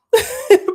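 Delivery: high loud laugh; loud burst of laughter. Real, not acted. Laughing at own predicament.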